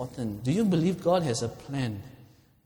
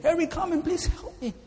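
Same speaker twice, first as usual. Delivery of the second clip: high-pitched pleading call; cry for help; 12 semitones higher than usual. Acted. Wounded soldier begs his buddy for rescue, high pleading cry. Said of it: "Again, the voice came in".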